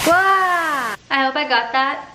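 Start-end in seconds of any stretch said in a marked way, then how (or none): 0.1-0.9 startled yelp. Real, not acted. Pot boils over.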